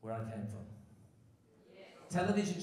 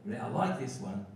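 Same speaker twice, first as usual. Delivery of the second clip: ordinary delivery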